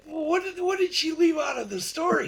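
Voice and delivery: very high, exasperated disbelief; imitated flustered outcry; retold alarmed question. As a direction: demand it in a high, hammed-up panic. Retold alarm at withheld news.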